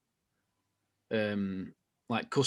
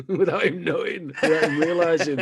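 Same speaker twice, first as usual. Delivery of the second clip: laughing; 4 semitones higher, 4 dB louder than usual. Real, not acted. Laughing recalling the handcuff prank.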